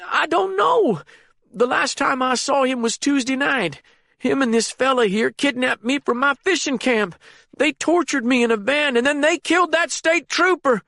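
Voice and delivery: put-on high, panicked frightened old man. A narrator's reading. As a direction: blurt it out in terror. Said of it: "in his panicked state".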